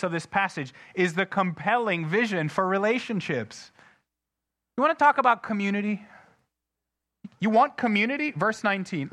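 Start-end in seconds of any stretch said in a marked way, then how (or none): 4.8-8.3 louder, higher, fast challenge; rousing challenge. Acted. Preacher challenging his church, rousing and loud. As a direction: throw the question at the congregation.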